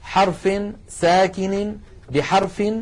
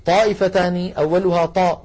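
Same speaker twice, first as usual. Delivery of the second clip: normal talking voice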